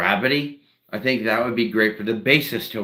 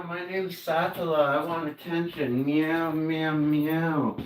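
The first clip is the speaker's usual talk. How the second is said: higher, needy pet voice asking for attention; cat's voice; 6 semitones higher, 5 dB quieter, slower than usual. Pretend. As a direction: play the cat begging for attention. Speaking as his cat.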